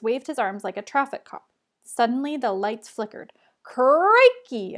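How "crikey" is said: booming bellow through a microphone. A narrator's reading. Host bellowing to the gym crowd, loud booming call. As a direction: belt out the greeting, loud and falling. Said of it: "a voice bellowed through a microphone".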